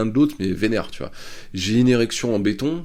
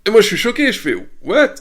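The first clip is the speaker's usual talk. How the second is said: high, loud mock shock; a burst of retold surprise, pitched up; 13 semitones higher, 7 dB louder than usual. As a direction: act stunned by the kiss.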